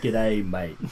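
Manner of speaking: plain, easy Australian accent; Australian greeting demo. Shown as a demonstration. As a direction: model the Aussie G'day for a learner. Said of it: "all you have to do is just say".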